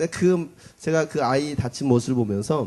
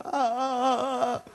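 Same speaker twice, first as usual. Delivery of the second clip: imitated whimpering; 9 semitones higher, much slower than usual.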